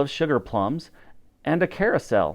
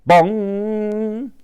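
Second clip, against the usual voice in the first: clock bong; 8 semitones higher than usual. A narrator's reading. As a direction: ring out like a big clock bell. Clock bell sound effect.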